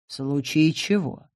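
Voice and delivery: quiet wary question; wary, hushed. Acted. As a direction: ask it softly, on guard. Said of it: "насторожилась я".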